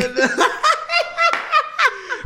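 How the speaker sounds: shrieking high laugh. Real, not acted. Hysterical laughter.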